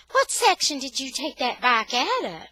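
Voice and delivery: high, loud questioning lady; curious store lady. A narrator's reading. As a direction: ask it like a puzzled clerk.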